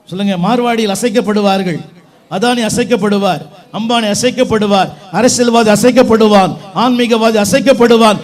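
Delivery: loud, driving sermon declarations; forceful preacher building to an Amen; preaching at full force. Real, not acted. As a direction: proclaim each line to rouse the congregation.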